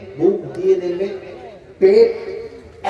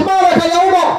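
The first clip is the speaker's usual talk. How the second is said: high, alarmed question; acted high-pitched cry; urgent calling out; 9 semitones higher than usual. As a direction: call out as you run up. Companion runs up, calls to Omar.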